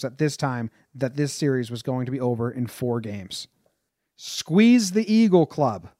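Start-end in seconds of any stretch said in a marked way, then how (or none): 4.2-5.9 higher, drawn-out mock shout; mild narrated shout. A narrator's reading. Ruth taunting the Chicago dugout, mild shout. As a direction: call it out slowly, raised but not full volume. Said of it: "he shouted at the Chicago dugout".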